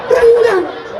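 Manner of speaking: one shouted word, pitched high and drawn out; loud exclamation. Real, not acted. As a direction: shout it out in mock outrage. Preacher mocks the gap in the row.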